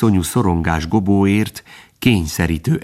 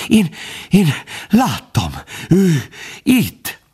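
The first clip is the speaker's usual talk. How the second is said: high, broken bursts of fear; halting, panicked gasps; terrified stammer; 9 semitones higher, slower than usual. A narrator's reading. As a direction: stammer it out word by word in fright.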